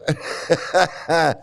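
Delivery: short laugh; light laugh. Real, not acted.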